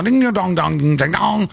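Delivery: usual speaking voice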